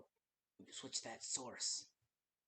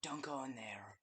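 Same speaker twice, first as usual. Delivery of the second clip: usual speaking voice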